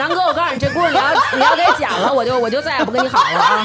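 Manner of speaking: laughing. Real, not acted. Laughing mock threat to friend.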